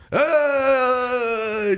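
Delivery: sudden high drawn-out yell; a wild, annoying yell; menacing yell. Retold or imitated. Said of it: "just being a menace".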